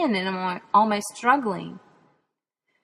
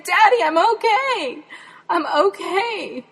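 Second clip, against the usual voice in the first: 1 laughing reassurance; 11 semitones higher, 4 dB louder, slower than usual. Retold or imitated.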